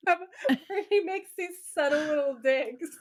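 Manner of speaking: words pushed out through giggles; laughing. Real, not acted. To her co-host about Dean's digs, laughing. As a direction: laugh through it, slowing at the end. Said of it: "It's so funny".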